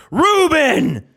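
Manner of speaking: put-on sudden high shouted order, like a confused old man; an old man yelling his order; crazy old man yell. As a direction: blurt the sandwich name at the counter. Old man barking order at counter.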